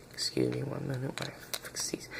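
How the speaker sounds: quick real whisper aside. Real, not acted. Reviewer pausing to fix the back pieces, whispered. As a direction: murmur it fast under your breath. Aside while fixing the figure.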